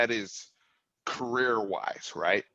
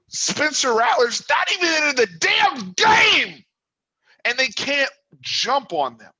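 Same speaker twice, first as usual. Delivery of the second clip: much higher, louder angry yell; 13 semitones higher, 7 dB louder than usual. Real, not acted. Angry fan yelling to co-host about a missed chance. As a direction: shout it out in disbelief. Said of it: "I'm sorry I'm yelling".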